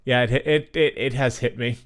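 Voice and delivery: tipsy, words stumbling. Real, not acted. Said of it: "I'm really drunk right now".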